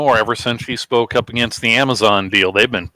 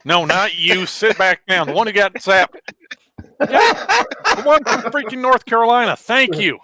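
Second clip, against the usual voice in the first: mock roll call; 7 semitones higher than usual.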